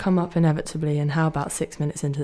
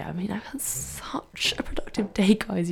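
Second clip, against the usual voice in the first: whisper; 2 semitones higher, 5 dB quieter than usual. Real, not acted. Whispered aside about productive day.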